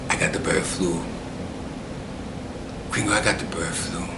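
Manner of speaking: very soft, low, half-whispered admission; imitating a friend's hushed bad news; hushed confession. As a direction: confess it quietly, barely above a whisper. Friend's grave confession in story.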